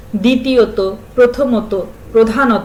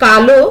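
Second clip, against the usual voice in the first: কালো said louder, with a clear final o; stressed word; 7 dB louder than usual. Shown as a demonstration. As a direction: lean on কালো, sounding its ending o. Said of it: "উচ্চারণের বিভ্রান্তির সুযোগ থাকলে".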